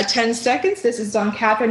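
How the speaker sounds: usual speaking voice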